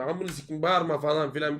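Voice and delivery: usual speaking voice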